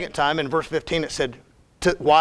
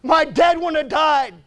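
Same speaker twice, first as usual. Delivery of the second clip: high, strained wail of grief and protest; loud, drawn-out, high-pitched cry of protest; anguished outcry; 10 semitones higher, 3 dB louder, slower than usual. Retold or imitated. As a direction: cry out to God in bitter anguish. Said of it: "do you know what my response was".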